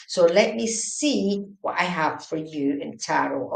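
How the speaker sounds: ordinary talk, nothing marked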